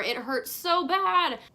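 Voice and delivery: loud, high, drawn-out lament; theatrical cry of hurt; dramatic anguish. Real, not acted. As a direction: wail it slowly, stretching each word. Dramatic climax of her disappointment.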